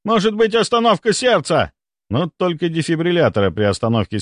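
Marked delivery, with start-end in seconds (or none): 0.0-1.7 high, alarmed guess; panicked shout. A narrator's reading. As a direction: call out the worst fear. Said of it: "кричал кто-то ещё".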